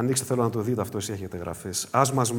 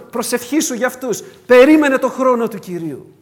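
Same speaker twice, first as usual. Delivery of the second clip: higher, louder exhortation, urgent repeated commands; preaching at full force; 8 semitones higher, 10 dB louder than usual. Acted. Pastor urging church members to trust leadership, loud exhortation. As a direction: command the flock to wait and be calm.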